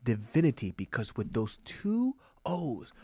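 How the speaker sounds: slow, weighty sermon with dramatic pauses; mock preacher. Preaching on the word woof, slow mock sermon. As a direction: stretch key words, dropping to a hush.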